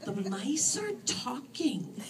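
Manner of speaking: slow, patient statement of the obvious; measured patient voice; patiently. A narrator's reading. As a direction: explain slowly as if to a slow child. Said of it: "said Istis patiently".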